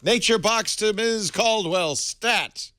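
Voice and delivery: high, slow public-page announcement; mock paging call. Host ordering snacks for a colleague, mock paging call.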